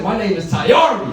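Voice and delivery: high-pitched, rousing mock shout; defiant proclamation. Standing up beside his coworker, proudly proclaiming his name. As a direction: proclaim the name like a battle cry.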